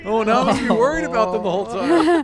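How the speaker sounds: loud, high burst of worry; mock alarm. Captain to the party, suddenly fretting about baby birds, loud and high. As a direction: throw up your hands in sudden mock worry. NPC reacts to baby bird story.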